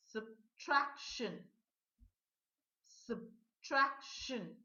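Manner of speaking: "subtraction" said slowly as sub-TRAK-shun; slow model word. Shown as a demonstration. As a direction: draw out each syllable of "subtraction". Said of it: "Number five".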